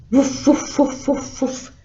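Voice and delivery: punchy dog impression; dog woofing. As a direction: woof like a yapping dog. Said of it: "And the dog went".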